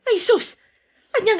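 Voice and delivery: a sudden indignant gasp of disbelief; surprised. Acted. Wife shocked at her husband's jealous accusation, indignant.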